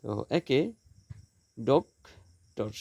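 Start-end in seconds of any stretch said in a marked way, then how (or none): 1.6-2.7 "doctor" slowly as ডক, pause, টর; syllable by syllable. Shown as a demonstration. Teacher counting syllables for students, "doctor" slowly split in two. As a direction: break "doctor" into two separate beats. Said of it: "দুটি syllable".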